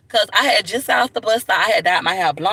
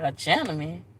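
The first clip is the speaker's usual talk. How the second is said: skeptical retort; 3 semitones lower, 8 dB quieter than usual. Retold or imitated.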